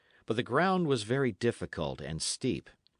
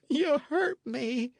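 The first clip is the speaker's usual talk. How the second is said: put-on higher, slow tearful boy's voice; sobbing lad, higher; 3 dB louder, slower than usual. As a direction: cry out the hurt through sobs. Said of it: "sobbed Matcham".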